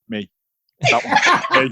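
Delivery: high, laughing mock pride at the match; proud nudging fella. Friend nudging mates at a match, proud and laughing. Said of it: "nudge you and be like".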